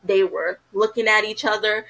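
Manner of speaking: normal talking voice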